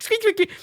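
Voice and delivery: high squeaking sounds; guinea pig squeaks. Retold or imitated. Guinea pigs begging at the fridge, squeaking high. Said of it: "I'm like".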